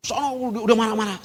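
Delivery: high-pitched mock scolding; imitated anger. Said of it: "si ibu ini udah marah". (menirukan ibu yang marah)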